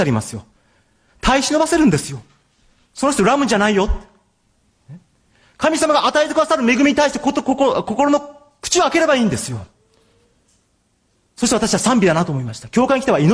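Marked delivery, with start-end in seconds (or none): 1.2-9.6 a high, urging preacher's call; fervent preaching. Acted. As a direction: preach it out to the congregation with fire. Preaching with rising force.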